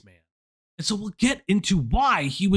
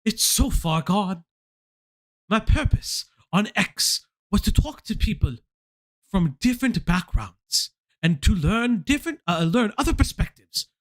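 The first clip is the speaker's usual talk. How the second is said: higher, hammed-up British accent; 5 semitones higher than usual. Host reading a British man's apology, mock British accent. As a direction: read it as a mock-solemn Brit. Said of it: "this is a U K accent".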